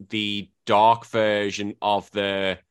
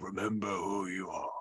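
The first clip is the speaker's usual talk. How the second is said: much softer, ghostly fatherly voice; hushed mock Mufasa from the sky; a hint of booming lion king, hushed; imitating Mufasa; 2 semitones lower, 12 dB quieter than usual. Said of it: "Mufasa's like".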